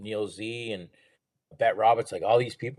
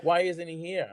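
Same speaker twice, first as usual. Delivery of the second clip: higher, curious mock voice; Henry's question; 8 semitones higher than usual. Henry Winkler asking about the brother, higher voice. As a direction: ask it lightly, as the star. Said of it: "He's like".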